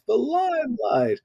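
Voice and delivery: high, drawn-out starstruck cry; grandly theatrical, high-pitched longing; mock vainglory. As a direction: swoon over stardom, hammed up. Mocks own youthful vainglory.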